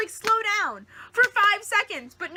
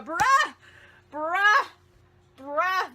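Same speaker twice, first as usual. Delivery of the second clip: exclaiming; 2 semitones higher, 4 dB louder, much slower than usual. Real, not acted. Exclaiming in disbelief.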